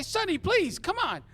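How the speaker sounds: very high, loud pleading small-time crook; flustered high-pitched protest; embarrassed pleading. Acted. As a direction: beg him in a high, squeaky voice. Mo pleads, embarrassed before woman.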